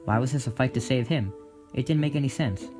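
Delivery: ordinary delivery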